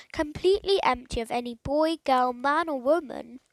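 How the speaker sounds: ordinary delivery, nothing marked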